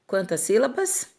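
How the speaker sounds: ordinary delivery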